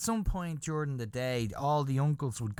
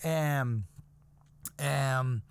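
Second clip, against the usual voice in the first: usual speaking voice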